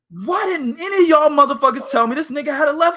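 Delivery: laughing, hammed-up fury; mock indignant. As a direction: rant at the promoters in mock outrage.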